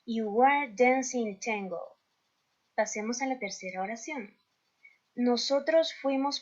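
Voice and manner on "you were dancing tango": slow, careful English sentence; teacher reading the answer to the class slowly; slow model reading. Shown as a demonstration. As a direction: read the answer slowly for learners. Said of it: "teacher reads completed answer".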